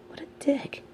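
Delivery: sigh, then whisper it in disgust; a disgusted aside, barely breathed. Acted. Villain muttering about his nemesis's cruelty, whispered with a sigh.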